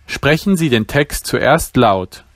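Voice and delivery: usual speaking voice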